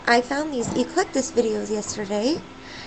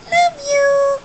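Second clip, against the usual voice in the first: loud, high, drawn-out farewell; squeaky pet-voice sign-off; 13 semitones higher, 6 dB louder, much slower than usual. Pretend. Owner signing off from herself and Skylar, squeaky pet voice. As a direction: stretch it out high, like a parrot.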